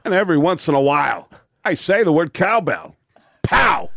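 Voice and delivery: Walken impression. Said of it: "And I went".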